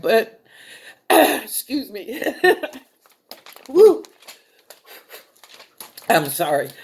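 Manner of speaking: throat-clearing, real choked-up apology; holding back tears. Real, not acted. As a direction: break off to compose yourself, then apologize. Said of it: "I'm trying not to cry".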